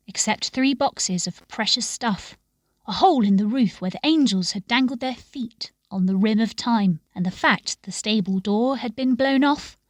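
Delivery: usual speaking voice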